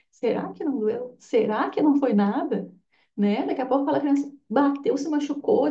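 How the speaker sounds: ordinary talk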